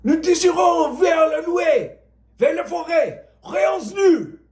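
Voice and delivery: high, slow French shout; yelled in French. Acted. Renoir yelling after Francois in French, high slow shout. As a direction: shout the order across the yard in French. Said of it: "Renoir yelled after him".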